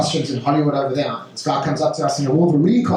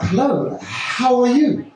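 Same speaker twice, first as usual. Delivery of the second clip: high, slow, over-energetic greeting; hammed-up eager greeting; overeager hello; 8 semitones higher, much slower than usual. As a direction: pour too much energy into the hello. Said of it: "It's like".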